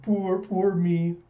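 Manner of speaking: slow, drawn-out woe-is-me; mock self-pity. [name] pities himself before his friends, mock pitiful.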